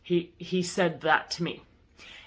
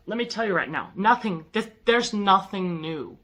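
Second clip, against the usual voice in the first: ordinary talk, nothing marked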